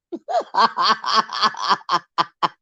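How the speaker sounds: long rolling real laugh; helpless run of laughter. Real, not acted. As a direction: crack up at yourself. Laughing at own over-investment.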